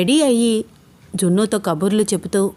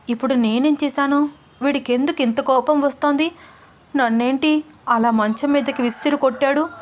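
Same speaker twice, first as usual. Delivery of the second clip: put-on higher, puzzled and hurt young woman's voice; 5 semitones higher than usual. Ananya hurt after Abhiram's angry outburst, puzzled inner voice. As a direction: wonder aloud, stung by his anger.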